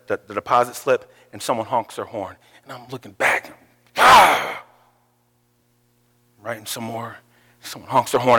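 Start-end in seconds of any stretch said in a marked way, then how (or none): 4.0-4.6 startled cry. Retold or imitated. Startled by a honk.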